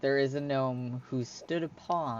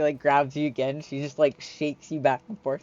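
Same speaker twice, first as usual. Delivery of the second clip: normal talking voice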